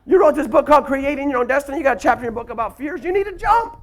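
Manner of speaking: high wife voice urging him; wife's voice. Retold or imitated. Wife telling husband to jump, high voice. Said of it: "She said".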